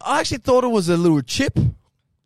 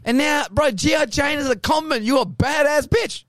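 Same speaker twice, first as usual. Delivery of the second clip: raised, higher ranting voice; 7 semitones higher than usual. Real, not acted. Heated rant about actress.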